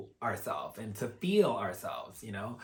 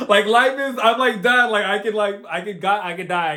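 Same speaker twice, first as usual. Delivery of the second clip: excited; 7 semitones higher, 8 dB louder than usual. Real, not acted. Overwhelmed joy, exclaiming.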